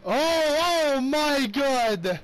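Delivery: high, drawn-out, loud cry of shock; stunned shout. Real, not acted. Gamer yelling in shock at the goalie play. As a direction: yell the goalie's name in disbelief.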